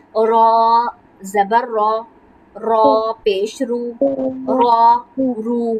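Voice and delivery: را and رو said thick and full, rolled slowly; heavy ra, slow. Shown as a demonstration. Teacher modelling the thick را for a student. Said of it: "تو ہم نے اس کو موٹا پڑھنا ہے".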